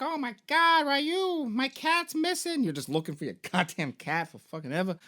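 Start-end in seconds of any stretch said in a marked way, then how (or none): 0.0-2.6 put-on high, slow little girl voice; mock kid voice asking for her lost cat. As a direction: squeak it out like a worried little girl. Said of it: "She's like".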